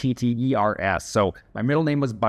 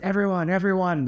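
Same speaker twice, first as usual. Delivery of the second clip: higher-pitched imitation of a bully's announcement; mock public announcement, pitched up; announcing to team; 7 semitones higher than usual. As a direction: call the room to attention, without shouting. Bully calls whole team to attention.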